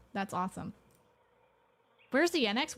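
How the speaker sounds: ordinary talk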